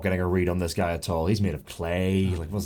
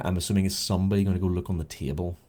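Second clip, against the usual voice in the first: ordinary delivery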